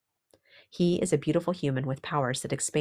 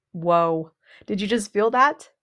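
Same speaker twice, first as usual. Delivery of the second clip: loud, high-pitched astonishment; amazed; 6 semitones higher, 7 dB louder, slower than usual. Acted. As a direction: sound thrilled and amazed. Amazed reaction to the affirmation.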